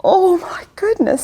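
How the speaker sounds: usual speaking voice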